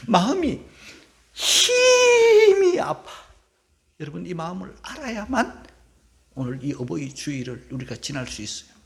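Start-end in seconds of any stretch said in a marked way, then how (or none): 1.3-3.1 높이 치솟는 울음 섞인 목소리, 아주 느리게; 가슴이 찢어지듯 길게 끌며 울먹여; 울먹임. Acted. 아버지의 아픔을 울며 표현. (아버지의 아픔을 울먹이며 전하는 설교자)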